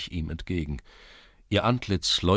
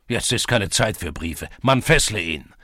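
angry order; 3 semitones higher, 7 dB louder than usual. A narrator's reading. Headman refuses, orders arrest.

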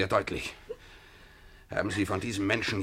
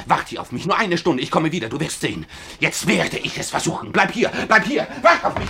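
rising, louder, higher pleading; urgent excitement; 7 semitones higher, 6 dB louder than usual. Acted. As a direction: build the urgency as you rush off. Alexei urgently pleads as he leaves.

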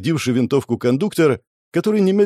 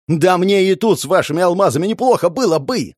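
higher-pitched heated protest; yelling; 7 semitones higher, 3 dB louder than usual. A narrator's reading. Cornered man protests he was fine here, yelling. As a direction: burst out in exasperation. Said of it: "заорал Игорь".

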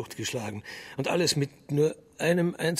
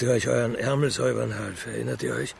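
normal talking voice

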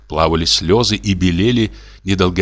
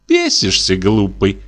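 ordinary delivery, nothing marked

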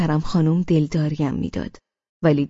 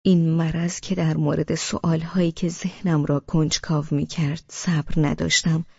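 ordinary delivery

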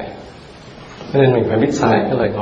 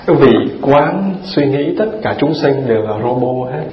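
ordinary delivery

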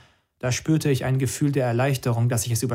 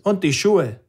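higher, louder added command; commander's order; 6 semitones higher, 4 dB louder, slower than usual. Acted. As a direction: tack it on coldly.

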